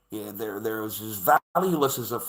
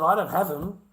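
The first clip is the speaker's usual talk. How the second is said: lawyer's reply; 7 semitones higher, 5 dB louder than usual. Retold or imitated. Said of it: "She said".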